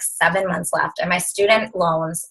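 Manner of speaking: ordinary delivery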